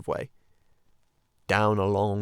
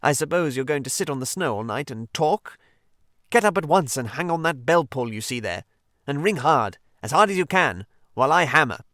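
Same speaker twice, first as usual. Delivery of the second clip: bossy Rat, building louder to the end; impatient orders; 4 semitones higher, 5 dB louder than usual. Acted. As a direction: order him about, louder each phrase. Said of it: "rather unkindly".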